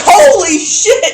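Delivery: high, loud, slow mock shock; shocked exclamation. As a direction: act out the table's stunned reaction. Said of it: "and we were like".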